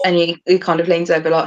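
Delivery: ordinary talk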